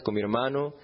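usual speaking voice

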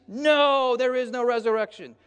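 high hammed-up protest; indignant Sadducees. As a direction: fire back like an offended rival. Sadducees voiced in the quarrel.

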